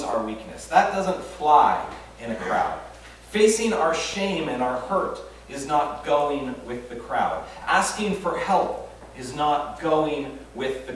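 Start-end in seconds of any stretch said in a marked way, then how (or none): none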